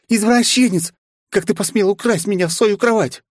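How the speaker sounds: high furious shriek; outraged shout. Acted. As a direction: scream at him outraged. Princess yells at pervert.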